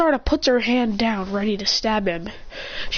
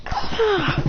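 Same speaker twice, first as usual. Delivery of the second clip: high hit cry; 10 semitones higher than usual. Acted. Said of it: "She goes".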